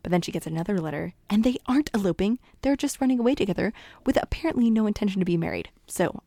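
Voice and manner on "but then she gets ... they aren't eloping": usual speaking voice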